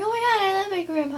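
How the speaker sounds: high, fast gushing; mock the relatives' cooing, quick and high; gushing relatives. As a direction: relatives gushing over grandpa. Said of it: "everyone's like".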